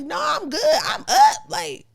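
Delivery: high, bright-eyed mock eagerness, breaking into a laugh; eager I'm up. Girlfriend replaying her own eager answer, high and laughing. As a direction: act wide awake and ready.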